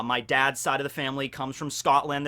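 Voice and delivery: usual speaking voice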